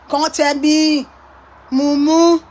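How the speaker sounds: usual speaking voice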